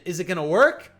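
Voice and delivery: rising hype; excited anticipation. Real, not acted. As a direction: lean in, wanting it to land. Tense moment in the fight.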